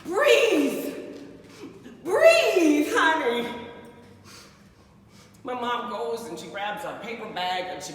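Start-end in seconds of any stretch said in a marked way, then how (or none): 0.0-3.5 louder and higher, insistent; mother urging her panicking daughter; urgent mother. Retold or imitated. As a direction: coax her to breathe.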